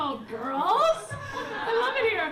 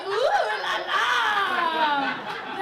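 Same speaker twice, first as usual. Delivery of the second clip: long, high, loud drawn-out coo; hammed-up swoon, stretched out; mock swooning; 6 semitones higher, 7 dB louder, much slower than usual. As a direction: imitate giddy girls cooing over romance. Mock horny girls' swooning cry.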